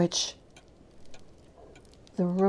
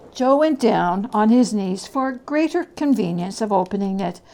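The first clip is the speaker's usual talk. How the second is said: ordinary delivery, nothing marked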